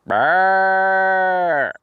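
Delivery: loud held brrr of cold; shivering brrr. Acted. Shivering sound in cold morning.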